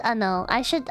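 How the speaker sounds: ordinary talk, nothing marked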